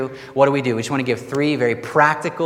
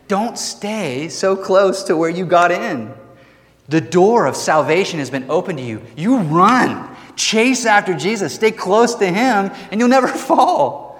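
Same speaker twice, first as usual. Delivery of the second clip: higher, louder urgent preaching; rally the congregation to run after Jesus; preaching at full force; 6 semitones higher, 3 dB louder than usual. Acted. Sermon climax, urgent exhortation.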